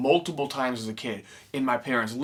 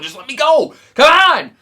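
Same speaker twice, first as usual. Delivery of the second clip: much higher and louder begging; pleading outburst; 12 semitones higher, 11 dB louder than usual. Retold or imitated. Fan begging the lady to let him meet Robert, high loud plea.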